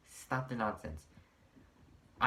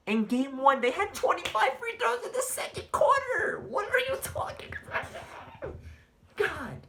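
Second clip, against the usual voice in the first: losing composure, much higher and louder, laughing in disbelief; exasperated outburst; 7 dB louder than usual. Real, not acted. Ranting at Laker fans, losing composure in a high, loud outburst. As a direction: blow up at the fans in shrill disbelief. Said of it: "I'm trying to keep my composure".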